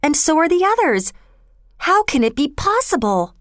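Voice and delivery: high, amazed exclamation; astonished cry. A narrator's reading. Girl watching the whole hillside move, astonished cry. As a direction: exclaim in disbelief, pitched well up. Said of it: "cried Bobby".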